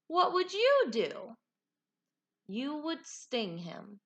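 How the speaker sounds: ordinary delivery, nothing marked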